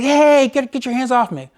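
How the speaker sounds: high, loud "hey" that settles by the end; retold angry yell. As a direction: shout it while pushing her hand away. Ex pokes finger in his eye.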